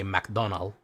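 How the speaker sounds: "McDonald" with the final S left off; dropped final S. Shown as a demonstration. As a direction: end "McDonald" on the L. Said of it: "also get rid of it".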